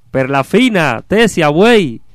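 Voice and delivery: high, loud ox-driver's call; desperate shout. Acted. Stuck carter yelling to his oxen, desperate. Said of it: "al gritar desesperado".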